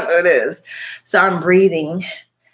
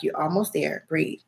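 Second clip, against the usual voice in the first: quiet self-talk; 2 semitones lower, 8 dB quieter than usual. Retold or imitated. Calming herself in elevator.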